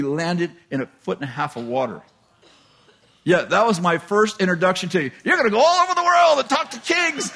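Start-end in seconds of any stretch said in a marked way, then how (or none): 5.2-7.3 high, shouted, hammed-up announcement; mock proclamation. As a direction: holler a grand promise in mockery. Mocking his first prophetic introduction.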